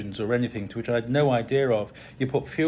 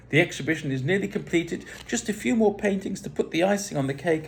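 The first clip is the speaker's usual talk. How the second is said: higher, brighter polite reply; bright, upbeat reply; 6 semitones higher than usual. A narrator's reading. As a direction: keep it high and cheery. Narrator's posh reply to Dennis.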